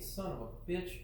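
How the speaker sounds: ordinary delivery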